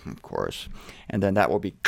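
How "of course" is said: very low, soft reply; understanding God. Acted. God answering those who confess, low and quiet. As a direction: answer as God, deep and hushed. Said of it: "God will say".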